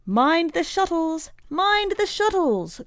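put-on high-pitched warning cry of an old woman; old woman's cry. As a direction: call out from a factory memory. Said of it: "cries old Missus Axelrod".

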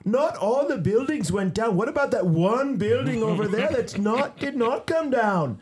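high, loud mock African accent. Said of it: "in his African accent".

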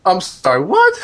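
stunned disbelief breaking into laughter; incredulous laughing what. Real, not acted. Host reacting to the shocking clip with stunned laughter. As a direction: pitch leaps up on "what" into a laugh.

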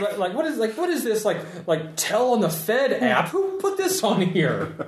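squeaky, puzzled questions; high confused voice. Acted. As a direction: ask in high bewilderment.

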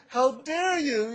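high, loud, drawn-out outraged quote; mock yell. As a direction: imitate an indignant yell, amused. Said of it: "She started yelling at me".

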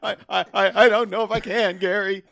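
high, loud, hammed-up stutter; mock stammering fear. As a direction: stammer like the panicked wife.

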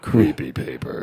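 low, slow spooky growl; low spooky narrator; creepy voice. Pretend. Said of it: "my creepy voice".

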